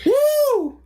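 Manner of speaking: long high-pitched woo; excited woo. Retold or imitated. Imitating the movie hero's woos, high whoop. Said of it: "him just doing a woo".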